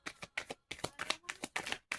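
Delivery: breathless theatrical gasp; a big fake gasp of outrage; mock gasp. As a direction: gasp like you're scandalised. Mock shock at a card.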